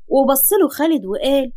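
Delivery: ordinary delivery, nothing marked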